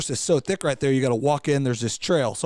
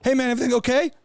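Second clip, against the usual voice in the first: higher voice, rising into the question; concerned stranger; 9 semitones higher, faster than usual. Retold or imitated. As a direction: imitate a passerby checking on someone. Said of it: "this guy's like".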